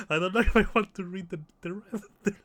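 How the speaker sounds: fast, high, loud burst through laughter; laughing hard. Real, not acted. Player hesitating to read the rest, laughing hard. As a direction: blurt it out while still cracking up. Laughing fit over game text.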